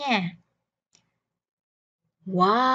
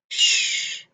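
shushing; 3 dB louder than usual. Shown as a demonstration. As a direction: hush the class with a shh. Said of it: "Im lặng".

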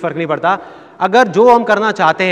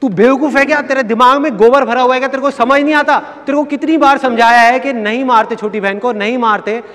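raised, higher voice of a father scolding his son; angry father scolding; 5 semitones higher, 3 dB louder than usual. Retold or imitated. Tired father back from the office scolds his son, angry and raised. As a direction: scold him like a fed-up dad. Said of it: "आ करके".

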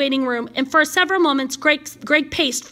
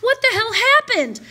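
higher-pitched, urgent questioning; alarmed concern; 7 semitones higher, 3 dB louder than usual. Acted. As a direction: burst in with worried questions. Friend rushes in alarmed.

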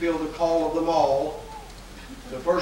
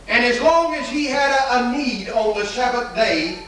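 preaching; 5 semitones higher than usual. Acted. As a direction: preach it out to the congregation. Preacher at full force.

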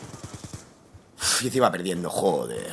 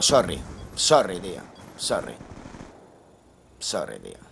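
fading apology; 8 semitones lower, 4 dB quieter, slower than usual. Real, not acted. Apologising to team after losing.

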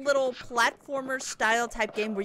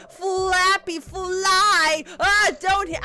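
urgent cheering; 5 semitones higher, 6 dB louder, much slower than usual. Real, not acted. Urging the character loudly.

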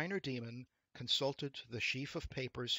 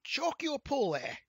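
high, loud rascal's objection; crying out in protest; 13 semitones higher, 8 dB louder than usual. A narrator's reading. Rascal objects to the devil's verdict, crying out. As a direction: burst out against the charge. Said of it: "cried the rascal".

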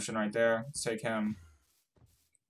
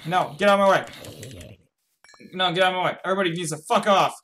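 raised, loud, flustered order; frantic irritation; 6 semitones higher, 5 dB louder, much faster than usual. Real, not acted. Player trying to reach Simon through a crowd, flustered anger. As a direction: shove everyone aside with your voice. Clearing others from the way.

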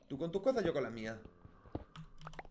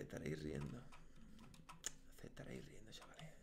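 whispered, trailing off to nothing; 13 semitones lower, 17 dB quieter, faster than usual. Real, not acted. Gamer whispering to viewers laughing at his death. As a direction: breathe it out, beaten and sulky.

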